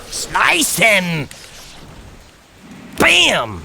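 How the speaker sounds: high-pitched game attack call; battle cry. Pretend. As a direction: call out the finishing blow with relish. Calling out attacks in game play.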